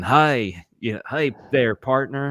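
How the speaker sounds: hammed-up Texan accent; full Texan. Imitating voice-over colleagues doing Americans, full Texan accent. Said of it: "they go full Texan".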